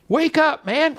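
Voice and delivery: urgent plea. Real, not acted. Preacher urging Big Bang believers to wake up, pleading. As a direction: jolt them awake.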